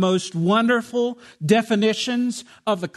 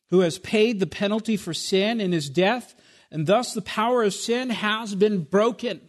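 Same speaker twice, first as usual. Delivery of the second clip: ordinary talk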